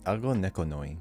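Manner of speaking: usual speaking voice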